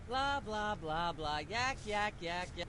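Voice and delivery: the rant trailing off very soft into blah blah; a soft droning ramble; fading rant. Acted. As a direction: mumble the filler words far under the voice. Host rant fades into blah.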